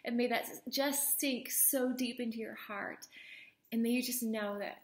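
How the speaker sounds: ordinary talk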